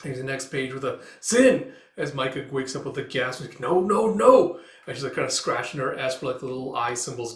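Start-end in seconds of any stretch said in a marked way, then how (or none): 1.2-1.7 high, loud single-word outburst; startled cry. Acted. Micah wakes with a gasp, crying out. 3.6-4.6 loud, high, climbing cries; panicked protest. Acted. Micah waking up with a gasp, panicked.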